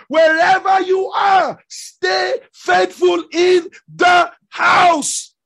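preacher drawing out each word; drawn-out preaching. Acted. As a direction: hammer it home slowly. Preacher driving home his point.

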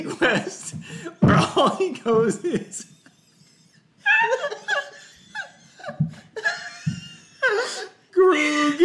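repeating it while cracking up; laughing. Real, not acted. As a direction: barely get it out through laughter.

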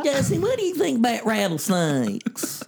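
put-on high young boy voice; [name] impression. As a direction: ask like a high-pitched kid host. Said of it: "I like your Mason impression".

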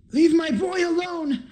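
high-pitched character scream at moderate volume; Donovich screaming. Acted. Game master as a parent defending their boy, screaming. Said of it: "Donovich screams".

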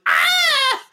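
high, loud mock scream of pain, half laughing. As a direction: yelp like you've just been shot, cracking up.

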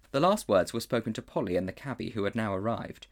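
ordinary delivery, nothing marked

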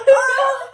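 high, loud imitated scream; hammed-up shriek of a panicked grandma; mock freak-out scream. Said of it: "She, like, went fucking insane".

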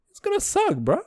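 high, quick laugh through the words. Real, not acted. Laughing to listeners about the lime cola, high and quick. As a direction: crack up while predicting it sucks.

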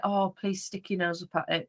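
softly begging the dog under her breath; hushed hopeful plea; quiet inner plea. Retold or imitated. Owner's silent plea to dog.

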